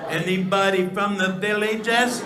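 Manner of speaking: stretched out, calling to the crowd. Real, not acted. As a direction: call it out again, drawn out.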